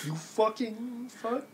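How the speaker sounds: soft, slow muttered insult; mumbled under breath. Acted. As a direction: let the curse slip out very quietly. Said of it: "you mumble that under your breath".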